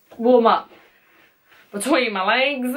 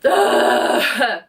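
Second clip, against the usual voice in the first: usual speaking voice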